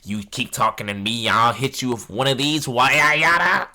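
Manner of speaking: loud mocking take on an angry mother; crazy lady. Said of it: "She said".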